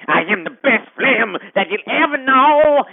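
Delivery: ordinary talk, nothing marked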